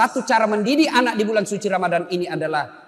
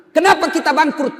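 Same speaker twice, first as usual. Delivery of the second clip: preaching question; 8 semitones higher, 4 dB louder than usual. Acted.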